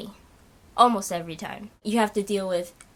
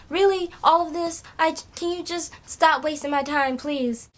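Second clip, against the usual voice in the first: exasperated retort; 7 semitones higher than usual. Retold or imitated. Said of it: "I'm like".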